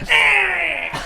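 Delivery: high startled yelp as he falls; a cartoon villain's yelp; mock yelp. GM voices crumbling villain.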